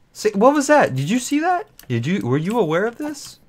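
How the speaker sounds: high, loud startled outburst. Real, not acted. Player startled by a creeper, yelping high. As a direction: jolt up in pitch at a sudden scare.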